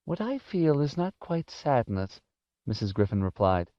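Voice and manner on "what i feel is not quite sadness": put-on high, restrained old-lady voice; gentle elderly woman explaining herself; sorrowful old woman. A narrator's reading. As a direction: pitch it up in subdued grief. Old woman explains her grief.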